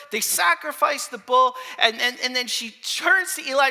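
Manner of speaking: loud, high, impassioned storytelling; full-force preaching. Real, not acted. Preacher tells of the barren mother's return, loud and fervent. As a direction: drive the story forward at full voice.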